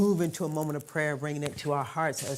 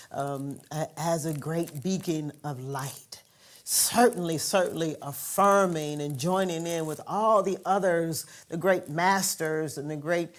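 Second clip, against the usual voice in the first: louder, rising preacher's cadence; fervent louder sermon voice; preaching fervently; 4 dB louder than usual. Acted. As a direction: lift the prayer into full-force preaching. Fervent offering prayer.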